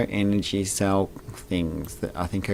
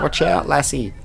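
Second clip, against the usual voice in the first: put-on Scottish accent; 5 semitones higher, 3 dB louder than usual. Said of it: "I won't do any more Scottish accent".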